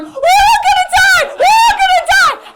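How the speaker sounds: put-on high-pitched, full-force panicked child's shriek; daughter screaming. Mother acts out daughter waking on the plane, screaming. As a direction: shriek it out like a terrified kid. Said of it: "she starts screaming".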